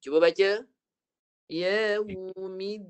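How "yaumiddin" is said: "Yaumiddin" very slow, stopping and holding the doubled d; held dal. Shown as a demonstration. Teacher models for student to read, held-dal recitation. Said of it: "dia mati tertahan suara".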